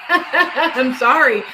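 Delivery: laughing apology, loud and slow. Real, not acted. Mocking psychics who predict a win, laughing loudly. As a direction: laugh it out, not sorry at all.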